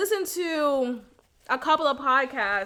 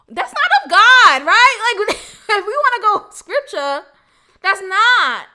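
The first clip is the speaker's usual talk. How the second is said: much louder, higher, laughing into it; preachy declaration; 6 semitones higher, 8 dB louder than usual. Real, not acted. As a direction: preach it half-joking. Preachy scripture aside.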